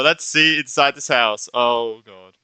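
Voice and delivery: ordinary delivery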